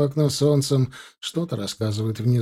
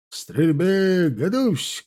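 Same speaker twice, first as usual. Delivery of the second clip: high shouted volley order; combat callout; 5 semitones higher, much slower than usual. Acted. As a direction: shout the firing command. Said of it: "крикнул Кравцов".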